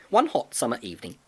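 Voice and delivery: ordinary delivery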